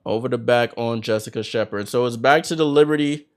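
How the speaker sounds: brisk live game call, names punched; play-by-play. Acted. Commentator calling the game for viewers, in lively play-by-play.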